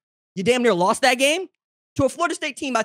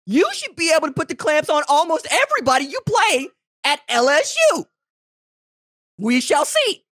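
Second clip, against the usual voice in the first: high and loud, building to a grand finish; fired-up hype; 8 semitones higher, 4 dB louder than usual. Real, not acted. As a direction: sell it like a bold prediction. Hyped prediction about LSU defense.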